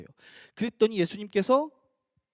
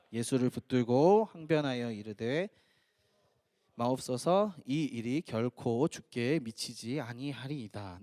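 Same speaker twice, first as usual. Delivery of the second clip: slow, low-pitched congregational reading; scripture reading; 6 semitones lower, slower than usual. Acted. Leading the congregation in the verse, solemn scripture reading. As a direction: read the verse out in measured, drawn-out phrases.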